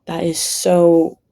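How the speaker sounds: usual speaking voice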